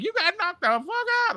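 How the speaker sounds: high excited crow over the knockout; gleeful high-pitched taunt; crowing line from a comedy film; mock hype man. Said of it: "he's like".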